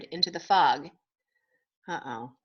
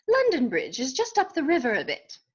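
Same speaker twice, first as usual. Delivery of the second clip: high British know-it-all duck; British accent; 4 semitones higher than usual. A narrator's reading. Duck corrects Dodsworth on the bridge, British accent. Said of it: "He spoke with a British accent".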